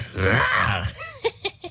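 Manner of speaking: low booming evil laugh; deep evil laugh. Acted. Demon gloats after his boast, deep evil laugh.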